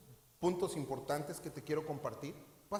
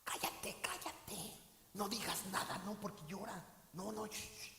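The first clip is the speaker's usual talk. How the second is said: soft, hushed shushing, barely voiced; hushing whisper; 2 semitones higher, 8 dB quieter than usual. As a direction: shush them like at a wake so nobody cries. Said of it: "mejor dicen". (susurro para callar, bajito y sin voz)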